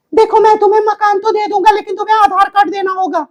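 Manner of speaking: fast high put-on landlord; landlord role. Shown as a demonstration. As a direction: lay down terms to the tenant quickly. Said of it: "देखिए क्या अभिनय".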